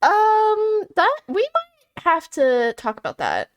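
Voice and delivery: high-pitched, hesitant mock psychiatrist; imagined psychiatrist. Psychiatrist carefully responding to a dark joke, high and hesitant. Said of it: "I'm sure a psychiatrist would be like".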